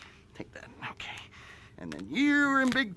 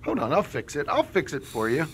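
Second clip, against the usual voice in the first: ordinary talk, nothing marked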